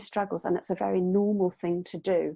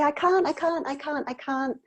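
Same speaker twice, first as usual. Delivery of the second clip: mock child whine; 6 semitones higher than usual. Child refusing, repeated whine.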